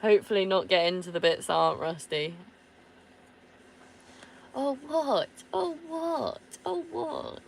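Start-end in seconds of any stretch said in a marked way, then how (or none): 4.5-7.4 high, drawn-out "Oh, what?"; mock surprise. Real, not acted. Owner reacting to her dog's stare with high mock surprise. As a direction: feign outrage at the dog.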